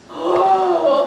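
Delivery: big audible breath out; deep calming breath. Shown as a demonstration. Teacher leading children to breathe out anger, deep sigh. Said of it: "breathe with me to help me get rid of my angers".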